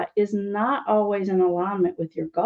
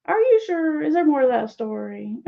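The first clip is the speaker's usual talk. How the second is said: playful, skeptical "are you sure" with a high lift; teasing doubt; 6 semitones higher than usual. Shown as a demonstration. Coach showing how to gently question a client, teasing. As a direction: tease them into telling more. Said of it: "I say it kind of in a teasing way".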